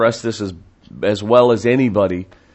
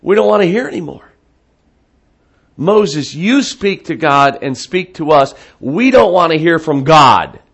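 raised, higher voice of a crowd begging off; loud, higher plea to be spared; acted fearful protest; 7 semitones higher, 7 dB louder than usual. Israelites telling Moses they won't hear from God, loud protest.

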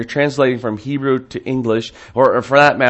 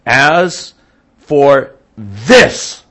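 loud, raised contempt with weight on THIS; contemptuous; 4 semitones higher, 8 dB louder, much slower than usual. Acted. The people dismissing Moses to Aaron, contemptuous. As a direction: spit out "this" with loud disdain. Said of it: "I think the contempt".

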